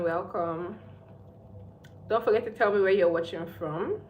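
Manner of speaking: usual speaking voice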